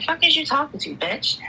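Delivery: retold Philly-girl challenge, pitch jumping up then dropping; mock confrontational Philly girl. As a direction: let the Philly girl come out. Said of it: "the Philly girl comes out of me".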